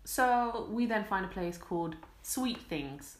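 ordinary delivery

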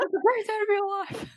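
high, sing-song mock stranger voice; cheerful stranger. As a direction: chirp it like a jolly passerby. Imitating cheerful stranger on street.

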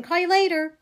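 loud schoolkid call; kids shouting. A narrator's reading. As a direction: call out over a noisy hallway. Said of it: "kids shouting".

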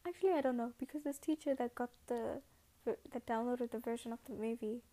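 ordinary talk

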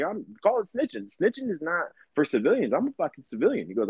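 usual speaking voice

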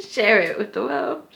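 high, amused and giggly; laughing. Real, not acted. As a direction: say it through laughter. Laughing at fart mouth.

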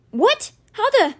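high, loud, startled anger; indignant, cut-off yell; explosive outburst. A narrator's reading. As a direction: burst out in shocked outrage. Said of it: "Alex exploded".

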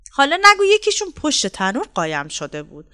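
ordinary delivery, nothing marked

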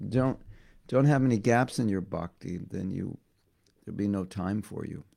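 ordinary talk, nothing marked

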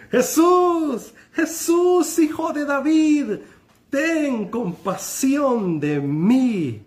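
high, loud pleading cry, the last line slow; desperate shout slowing down on the plea; beggar crying out. Acted. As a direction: beg for mercy at full voice. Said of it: "se puso a gritar".

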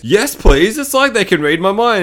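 hammed-up pleased bro; mock eager jock. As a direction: play the leering jock grabbing the joke. Jock voiced in retelling.

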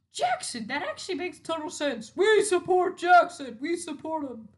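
rally behind Jackson; cheering classmates. Retold or imitated. Classmates backing Jackson, high and loud.

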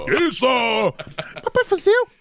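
high-pitched comic shout; catchphrase shout. Acted. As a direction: belt out the catchphrase.

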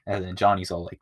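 ordinary talk, nothing marked

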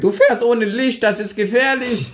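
put-on high, slow scolding old man; scolding grandpa. Grandpa scolds the bully for riding without a light, high and slow. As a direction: tell him off slowly like a fussy grandpa. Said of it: "hat den voll angemeckert".

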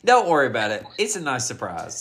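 ordinary talk, nothing marked

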